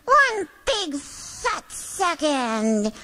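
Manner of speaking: drawn-out objection; slow, deliberate indignation; indignant slow protest. Acted. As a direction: stretch each word out, offended.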